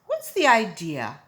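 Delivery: loud indignant demand; loud exasperated question; indignant barber. Acted. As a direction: demand an answer, irritated. Barber protests.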